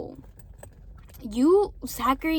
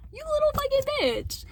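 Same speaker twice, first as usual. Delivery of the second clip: much higher, sneering put-on voice; mocking sneer; 13 semitones higher than usual. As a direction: spit it out in a mocking high voice. Imitating boyfriend insulting a friend.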